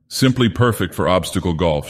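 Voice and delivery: plain reading voice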